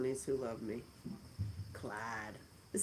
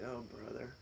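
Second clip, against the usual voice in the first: eye-roll scoff; 6 semitones lower, 13 dB quieter than usual. Retold or imitated. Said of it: "I just roll my eyes".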